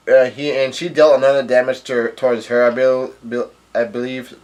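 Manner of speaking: ordinary talk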